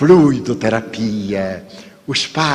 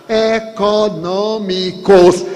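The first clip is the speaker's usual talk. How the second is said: "econômicos" spelt out slowly, each syllable weighted; 6 semitones higher, much slower than usual. Shown as a demonstration. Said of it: "pronunciei a palavra bem silabada". ("econômicos" bem silabado, devagar)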